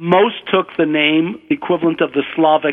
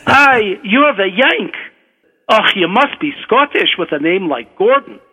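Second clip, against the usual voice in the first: hearty Scottish examiner, higher at first; Scottish accent; 4 semitones higher than usual. Acted. Professor greets his examinee, in a Scottish accent. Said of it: "try to do my Scottish accent".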